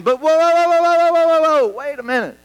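high, loud string of whoas; rapid-fire whoas held up on one high pitch; calling a halt. Acted. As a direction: pull up the runaway rant. Halting his own rant.

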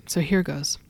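usual speaking voice

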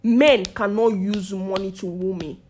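usual speaking voice

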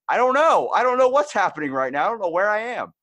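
high, loud flustered wail; mock panic. Caught copying someone's accent, flustered high-pitched mock panic.

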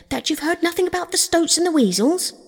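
high-pitched, incredulous demand; shout. A narrator's reading. Rat asks Toad about the Stoats, astonished shout. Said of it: "shouted the rat".

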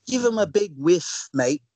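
slow, high put-on British accent. Podcaster showing off his accent to co-host, mock British accent. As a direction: ham up a British accent that lands Australian. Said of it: "How's my British accent?".

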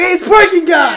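high, loud greeting shout; mock excited shout. Rapper spotting the parking guy, high loud mock greeting. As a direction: call out as if spotting an old pal. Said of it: "He's like".

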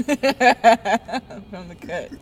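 slow words through laughter; laughing. Real, not acted. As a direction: laugh while saying it. Laughing at own joke.

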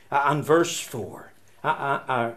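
ordinary talk

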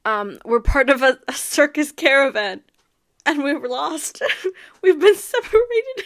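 put-on a kid blurting a lie in a rush; blurted lie. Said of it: "He just blurts out, like".

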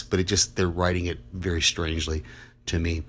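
usual speaking voice

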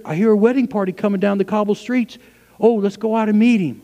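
high, loud eager preaching; full-force preaching. Acted. Pastor acting the ready wedding guest, loud excited preaching. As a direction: cry it out like a ready bride.

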